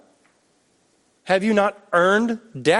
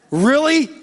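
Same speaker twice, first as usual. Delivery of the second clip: mild exasperation; 8 semitones higher, 3 dB louder than usual. Retold or imitated. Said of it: "he never just goes".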